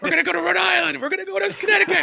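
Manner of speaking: high, fast, frantic stump-speech yell; squealing campaign rally voice; Governor Dean impression. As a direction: rattle off states like a manic candidate. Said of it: "Governor Dean that was like".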